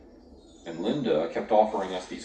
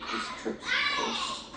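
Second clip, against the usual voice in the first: crying; 3 dB quieter than usual. Acted. Scared student in the van.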